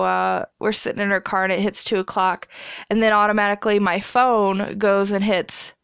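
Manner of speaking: normal talking voice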